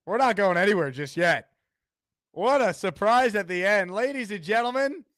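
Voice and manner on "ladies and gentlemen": hype announcer intro. Acted. Host hypes the caller's entrance.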